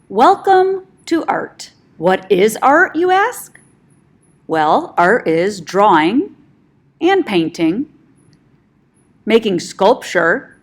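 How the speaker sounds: lively teacher presentation voice; upbeat presentation. Acted. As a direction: present it like a cheerful class video.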